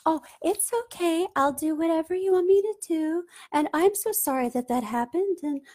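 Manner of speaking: high, eager, apologetic people-pleaser voice; high anxious voice, rushing at the end to offer a drink; fawning people-pleaser. Retold or imitated. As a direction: gush and grovel to keep them happy. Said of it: "Fawning is like".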